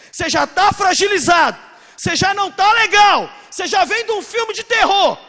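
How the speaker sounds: loud and high; preacher shouting at the crowd; preaching shout. Acted. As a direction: drive it home at full force. Preaching crescendo.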